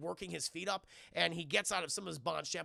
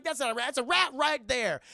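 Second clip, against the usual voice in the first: mock outburst; 7 semitones higher, 8 dB louder than usual. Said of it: "It's like".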